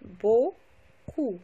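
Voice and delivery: "Beaucoup" split into beau, pause, coup; syllable by syllable. Shown as a demonstration. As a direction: break "Beaucoup" into two separate syllables. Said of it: "vient ici dans la première syllabe".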